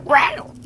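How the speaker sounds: loud imitated cat yowl. Imitating the cat in the graveyard with a loud yowl. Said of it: "it was like".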